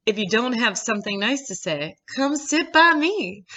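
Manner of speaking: put-on a light, teasing Southern belle accent from Steel Magnolias; fake Southern accent. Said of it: "That's my fake Southern accent".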